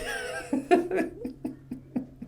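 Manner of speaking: airy giggle at own joke; quick breathy chuckle; breathy high laugh. Real, not acted. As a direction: laugh it off lightly.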